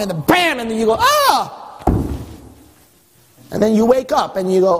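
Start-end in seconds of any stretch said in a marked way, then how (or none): 1.0-1.6 sudden high yelp as if struck; a short pained cry, played for laughs; cartoon ouch of a scolded child; mock yelp of pain. God strikes you with rod.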